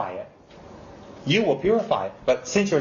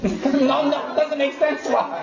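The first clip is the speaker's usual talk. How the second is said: laughing; 9 semitones higher, much slower than usual. Real, not acted. Laughing at own joke.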